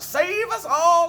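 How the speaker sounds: put-on very high, very loud, slow shriek of an old woman; mad old woman yelling. A narrator's reading. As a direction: screech it out the window, slow and shrill. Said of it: "she yelled".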